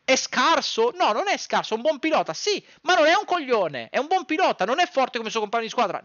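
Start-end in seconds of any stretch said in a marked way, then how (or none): none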